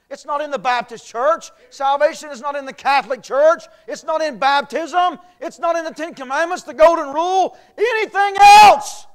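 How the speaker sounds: preaching at full force. Real, not acted. Preacher to congregation, climbing into a full-force sermon cry. Preaching what salvation is not.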